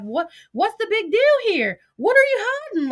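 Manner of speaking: high-pitched, incredulous questioning; baffled demand. Retold or imitated. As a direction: act out a baffled, exasperated demand. Voices own baffled reaction back then.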